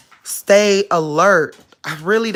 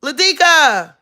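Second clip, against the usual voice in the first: high, loud call to the dog; 10 semitones higher, 5 dB louder than usual. Real, not acted. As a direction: holler the name through the house. Calling out to dog.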